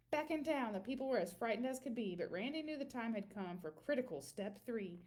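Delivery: plain reading voice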